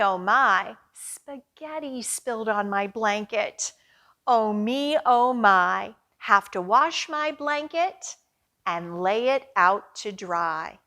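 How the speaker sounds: ordinary talk, nothing marked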